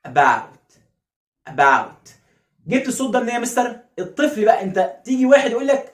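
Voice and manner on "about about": "About" low, loud, long open ow; unhurried correct model. Shown as a demonstration. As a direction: hold the ow in "About" before the t. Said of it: "مش هقفلها بسرعة". (نطق "About" بمد آو)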